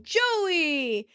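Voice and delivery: high, drawn-out calling after someone; mock shout. [name] shouts after Joey as he runs, calling out. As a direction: call his name down the hall. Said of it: "shouts after him".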